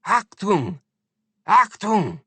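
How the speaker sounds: high, loud barked "Achtung!"; shouted German warning. A narrator's reading. Shouting at the crowd to scare the lady into dropping it.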